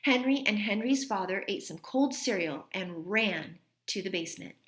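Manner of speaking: plain reading voice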